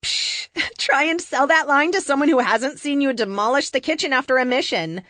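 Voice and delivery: amused teasing; laughing tease. Acted. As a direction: laugh as you call him out. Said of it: "Tessa says".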